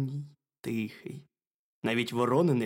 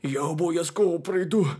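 higher, louder hare voice; raised little animal voice; 6 semitones higher, 6 dB louder than usual. A narrator's reading. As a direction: pipe up as a small hare. Hare promises to visit.

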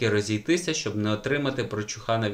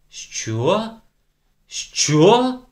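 high, loud shocked outburst; rising alarm in disbelief; 9 semitones higher, 8 dB louder than usual. A narrator's reading. Teacher shocked by the news. (приголомшене «Що?»)

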